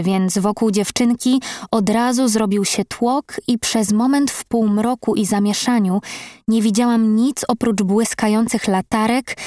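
usual speaking voice

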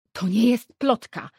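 loud indignant outburst; exclaiming. A narrator's reading. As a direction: fling the denial out loud. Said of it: "wykrzyknęła matka".

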